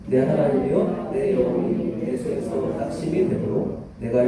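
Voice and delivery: slow, measured congregational reading; scripture reading in unison. Acted. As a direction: read the verse out slowly, together.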